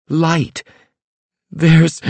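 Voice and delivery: high, slow ominous trance voice; fortune teller. A narrator's reading. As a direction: chant the vision with dread.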